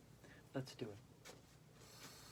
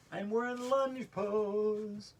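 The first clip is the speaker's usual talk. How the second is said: slow, stretched singsong, higher and louder; drawn-out announcement; 4 semitones higher, 5 dB louder, much slower than usual. Shown as a demonstration. Yoga teacher announcing the lunge pose, drawn out. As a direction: draw out "lunge pose" on held notes.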